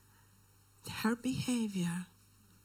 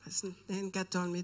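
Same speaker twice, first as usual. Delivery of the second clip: ordinary delivery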